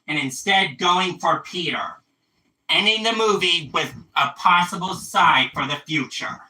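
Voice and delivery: usual speaking voice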